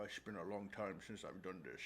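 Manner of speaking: low, hushed Scottish-accent impression; Sean Connery. Co-host asked for an impression, low Connery voice. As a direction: drop it deep and soft, like a Scottish film star. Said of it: "do Sean Connery".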